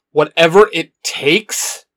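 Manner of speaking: slow, sarcastic echo; sarcasm. A narrator's reading. Jason mocking Shinon's promise, slow and sarcastic. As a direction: throw her words back mockingly. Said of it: "his voice dripping with sarcasm now".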